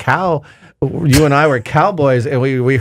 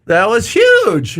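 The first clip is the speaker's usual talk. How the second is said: drawn out; 8 semitones higher, much slower than usual. Real, not acted. Stretched for emphasis.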